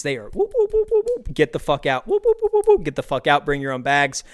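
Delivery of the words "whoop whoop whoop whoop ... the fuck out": high squeaky scanner whoops then barked order; shrill beeps, then shoo them out. Retold or imitated. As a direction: imitate the beeping checkout and a rushed cashier. Mimicking rushing Aldi cashier.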